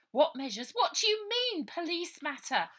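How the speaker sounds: put-on high, loud indignant girl's voice; cheeky girl standing her ground; defiant girl. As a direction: bristle at the copper. Ida defying the policeman.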